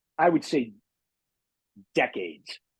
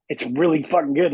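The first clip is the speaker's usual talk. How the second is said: usual speaking voice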